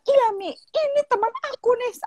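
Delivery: put-on high, childlike phone complaint to Mum; complaining daughter. Daughter reports a classmate to her mother, high plaintive imitation. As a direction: imitate an upset schoolgirl, pitched well up. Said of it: "dia bilang".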